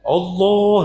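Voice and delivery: high, drawn-out prayer call; overly loud. Shown as a demonstration. Showing women what not to do, overly loud call. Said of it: "Pokok nggak banter-banter".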